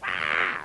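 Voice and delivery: short, loud mock scream; imitated scream. Imitating the patient screaming back at the therapist, mock scream. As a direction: burst out with a harsh "ah". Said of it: "scream back".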